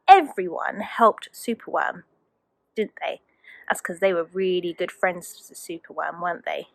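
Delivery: tender quiet question; soft aside. Real, not acted. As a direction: gently ask the little one. Talks softly to child.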